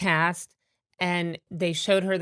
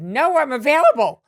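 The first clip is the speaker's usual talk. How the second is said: much louder and higher, eager; eager yes; 8 semitones higher, 10 dB louder than usual. Retold or imitated. Telling Joan's assistant she's free, loud and eager. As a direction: jump at the invitation.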